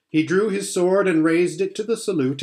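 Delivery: ordinary delivery, nothing marked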